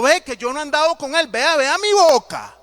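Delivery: high, loud, fast yelling; frantic shouting denial. Acted. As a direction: yell it fast to prove innocence. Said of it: "empezó a gritar".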